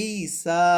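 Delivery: slow "ঈসা", both vowels drawn out; stretched recitation-style "ঈসা"; long-vowel recitation. Shown as a demonstration. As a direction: hold the ee, then the aa in "ঈসা". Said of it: "খাড়া জবর হিসেবে পড়ে ফেলবেন".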